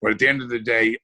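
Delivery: ordinary talk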